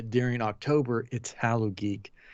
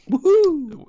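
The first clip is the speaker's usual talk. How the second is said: cheer; 11 dB louder than usual. Real, not acted. Cheering about skeleton night.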